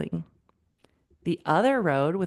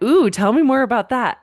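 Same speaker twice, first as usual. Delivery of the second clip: loud, higher, eager ooh; intrigued ooh; 4 semitones higher, 7 dB louder than usual. Real, not acted. Coach hearing Jenny's new approach, eagerly intrigued. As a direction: perk up and lean in.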